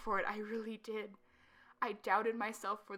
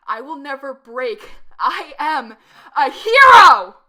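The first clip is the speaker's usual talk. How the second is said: rising to a manic yell; manic shout; 4 semitones higher, 26 dB louder, slower than usual. Acted. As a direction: scream it out triumphantly. Climactic delusional outburst.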